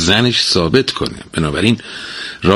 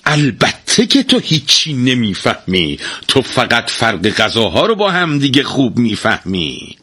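angry emphasis; 5 semitones higher than usual. Acted. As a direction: lean hard on each jab. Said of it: "با عصبانیت تأکید کنان گفت".

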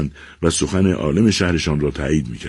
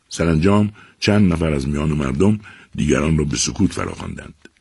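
plain reading voice

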